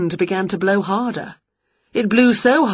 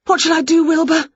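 panicked; 8 semitones higher, faster than usual. Acted. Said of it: "panicked Winnie".

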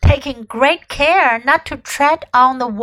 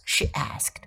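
ordinary delivery

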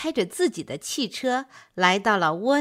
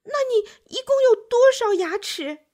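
高声惊问, 重读"多少"; 惊讶得提高嗓门; 吃惊地问; 12 semitones higher, 5 dB louder than usual. A narrator's reading. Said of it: "乌龟吃惊地问". (乌龟吃惊地高声问蜗牛)

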